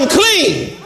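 high shouted unclean; hollered unclean. Shown as a demonstration. Preacher missing the congregation's holler, shouted cry. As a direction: holler the word like a leper. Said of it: "holler out".

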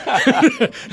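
high, amused laugh. Real, not acted. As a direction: burst out laughing. Guest laughs.

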